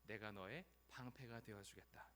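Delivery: low, soft solemn promise; God's voice. Acted. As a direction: pledge protection quietly and gravely. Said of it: "말씀하시죠". (낮고 엄숙하게)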